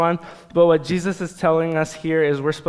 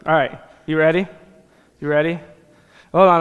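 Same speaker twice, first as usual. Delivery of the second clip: usual speaking voice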